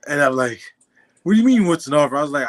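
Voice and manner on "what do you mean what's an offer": louder, higher disbelieving coach; incredulous. Retold or imitated. Coach in disbelief at the player, loud and high. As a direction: play the coach who can't believe the question.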